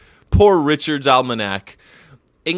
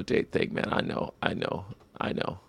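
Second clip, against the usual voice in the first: soft breathy whisper of agreement; 6 semitones lower, 12 dB quieter than usual. Real, not acted. Admitting the joke to the chat, whispered. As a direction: concede it under your breath. Sheepish reply after being teased.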